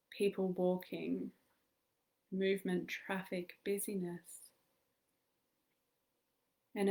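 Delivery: calm meditation guide with long pauses; measured, soothing guidance in short phrases; guided meditation. Acted. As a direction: lead listeners gently into stillness, leaving space to breathe.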